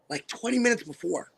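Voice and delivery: ordinary talk, nothing marked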